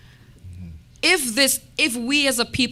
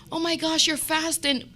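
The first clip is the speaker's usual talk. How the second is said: high, soft gushing in mockery; mock admiration; 8 semitones higher, 4 dB quieter than usual. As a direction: gush like the impressed outsiders. Mocking others' admiration.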